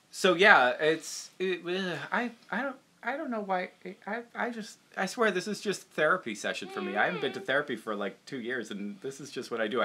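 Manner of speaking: ordinary talk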